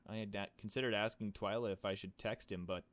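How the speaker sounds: ordinary talk, nothing marked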